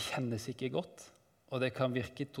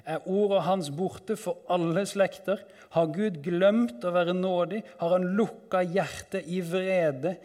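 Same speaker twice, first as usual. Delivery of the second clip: higher, pressing questions; anguished psalm reading; 5 semitones higher, 5 dB louder than usual. Acted. Psalmist asking if God has forgotten, anguished higher pleading. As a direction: plead with rising urgency.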